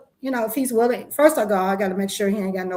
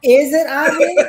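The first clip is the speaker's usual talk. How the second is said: laughing; 4 semitones higher, 8 dB louder than usual. Real, not acted. Laughing question.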